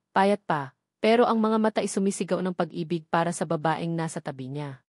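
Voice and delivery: plain reading voice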